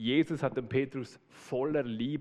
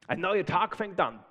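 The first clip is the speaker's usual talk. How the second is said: higher, louder excited boah; rooster's excitement; 5 semitones higher, 5 dB louder than usual. Retold or imitated. Preacher voicing the rooster sensing daylight, excited. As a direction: burst out as dawn breaks. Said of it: "wenn er spürt".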